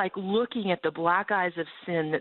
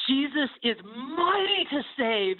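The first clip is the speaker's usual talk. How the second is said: awed proclamation; 7 semitones higher, slower than usual. Retold or imitated. Triumphant thought after fight.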